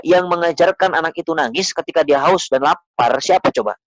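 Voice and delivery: ordinary talk, nothing marked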